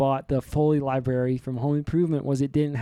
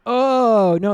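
higher, louder sudden oh no; mock dismay; 8 semitones higher, 7 dB louder than usual. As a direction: act out a comic realization. Realizing mistake in book.

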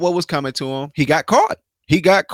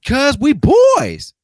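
much higher, loud, slow, drawn-out buddy voice; mock bro voice; 11 semitones higher, 8 dB louder, much slower than usual. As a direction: ham up the boys-club bravado, stretching "boys". Mocking the executives' bro talk.

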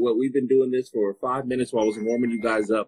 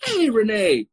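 excited greeting; slower than usual. Real, not acted.

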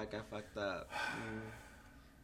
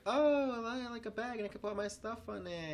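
much higher, gushing Janine; high excited quote; 13 semitones higher than usual. Retold or imitated. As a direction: gush over the bag. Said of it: "she's like".

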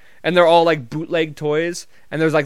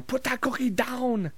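high, held-back mock yell; soft, stretched-out shouting impression; Arnold yelling; 9 semitones higher, 6 dB quieter, slower than usual. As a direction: imitate a movie yell at reduced volume. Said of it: "have Arnold just yelling".

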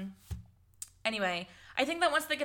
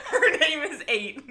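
laughing; 6 semitones higher, 9 dB louder than usual. Real, not acted. Laughing at the odd name.

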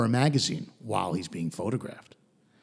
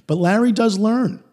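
usual speaking voice

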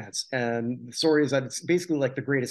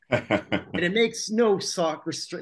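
usual speaking voice

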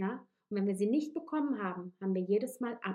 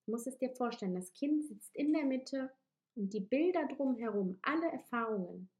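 guided meditation. Acted. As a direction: lead them gently into the images. Leads a guided visualisation.

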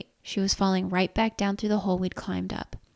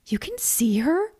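high, drawn-out shrill asking; slow, rising, shrill question; 7 semitones higher than usual. Acted. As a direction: ask it shrilly in disbelief. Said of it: "feeling shrill".

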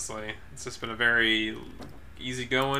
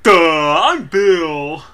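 silly clown; 3 semitones higher, 11 dB louder, much slower than usual. Acted. Goofy intro voice for Bill.

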